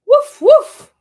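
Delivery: high, loud playful woof; dog bark. Pretend. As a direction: bark like a cartoon puppy. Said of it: "Mommy, you gotta say woof".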